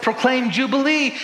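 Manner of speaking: high, loud declaration; proclamation. Acted. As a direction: proclaim it as a ringing command. Acting out God's proclamation.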